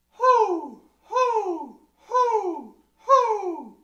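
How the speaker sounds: sharp high loud hoo from the belly; breath pulse from the belly. Shown as a demonstration. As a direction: pump a quick hoo from the belly. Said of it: "so try again".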